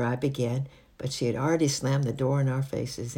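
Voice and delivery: plain reading voice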